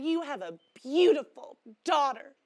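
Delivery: imitated compliment, high and loud; admiring churchgoers. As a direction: gush like churchgoers praising someone's daughter. Voicing churchgoers' compliment to mother.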